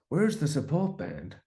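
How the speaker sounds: drawn-out, high put-on voice calling out. Musician imitating a star looking for the support band, bad impression. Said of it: "That's a really bad impression".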